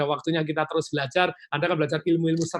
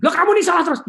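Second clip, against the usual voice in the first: angry accusation; 12 semitones higher, 8 dB louder, faster than usual. Retold or imitated. Role-played quarrel, angry spouse.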